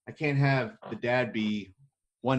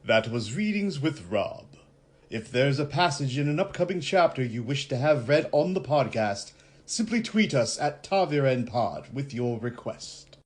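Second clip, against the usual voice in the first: announcer outro; 3 semitones higher, 3 dB louder than usual. Acted. Host closing the reading segment, announcer read.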